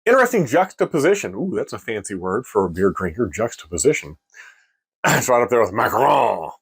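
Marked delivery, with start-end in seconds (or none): 5.0-6.5 mock French. Shown as a demonstration. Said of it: "my French accent".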